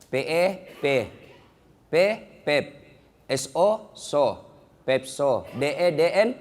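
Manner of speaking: halting beginner's reading, pausing between syllables; learner spelling aloud. Acted. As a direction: sound out letters one by one. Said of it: "Diajarlah membaca sama cucunya".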